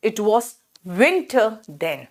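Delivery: winter then stretched out very slowly; very slow model phrase. Shown as a demonstration. Teacher modelling winter then for pupils, very slow. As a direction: drill the phrase slowly for the class. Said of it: "कैसे बोलोगे".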